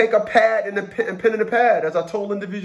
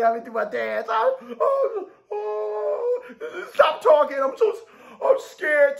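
high wailing fake sobs, hammed-up terror; squealing mock panic, cracking into sobs; mock scared crying; 8 semitones higher, slower than usual. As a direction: whimper and wail like a terrified child, way over the top.

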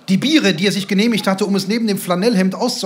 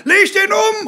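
short, high, loud bellow; furious high shout; snapped shout; 6 dB louder than usual. A narrator's reading. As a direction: order it at full yell from the touchline. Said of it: "schnauzte der Glatzkopf".

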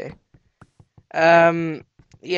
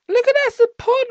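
rapid, high, loud burst; excited spotting; 6 dB louder, faster than usual. Real, not acted. Player pointing out a pond, fast excited squeal. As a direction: blurt it fast, voice way up.

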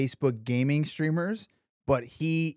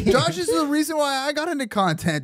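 high-pitched hype; 11 semitones higher than usual. Pretend. Hyping up Josh mockingly.

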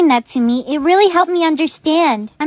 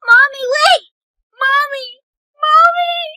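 high desperate calls after mom; child crying out for her mother; calling out; 8 semitones higher than usual. Acted. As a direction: call after her, pitch rising.

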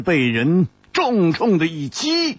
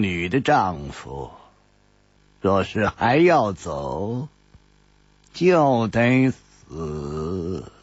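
cold; 9 semitones lower, slower than usual. A narrator's reading. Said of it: "冷冷地接着说".

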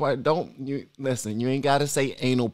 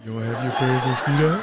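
laughing; 2 semitones lower than usual. Real, not acted. Repeats clip line while laughing.

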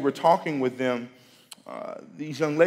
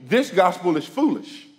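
raised preaching voice, a bit higher and louder; 8 semitones higher, 9 dB louder than usual. Acted. Preacher urging the congregation, full-voiced preaching. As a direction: preach it out to the room with conviction.

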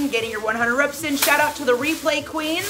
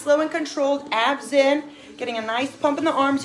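ordinary talk, nothing marked

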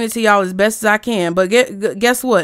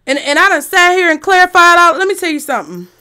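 higher, loud exasperated outburst; irritated; 6 semitones higher, 5 dB louder than usual. Real, not acted. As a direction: blurt it out, fed up. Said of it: "And I am very irritated".

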